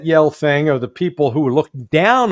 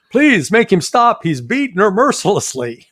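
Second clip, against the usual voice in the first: mock pleading caller; 6 semitones higher than usual. Mock caller to abuse hotline.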